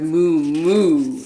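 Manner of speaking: a puzzled cow grumbling; muttered moo. Acted. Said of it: "who muttered".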